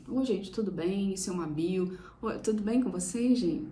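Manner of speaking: soft, withdrawn and unsure, swallowing the words; a shy creator who doesn't dare project; timid weak voice. Shown as a demonstration. Mentor demos a timid video greeting, soft and unsure. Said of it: "Se você falar assim".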